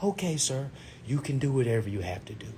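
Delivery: soft, unruffled customer-service voice; very polite. Retold or imitated. Financial advisor calmly answering an angry applicant, very politely. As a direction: answer mildly, giving no reaction at all. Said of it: "she said it so politely".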